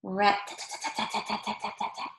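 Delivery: soft, breathy rat-a-tat of a beak on wood; hushed woodpecker drumming; woodpecker tapping. Retold or imitated. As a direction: tap it out quietly like a woodpecker. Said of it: "He goes".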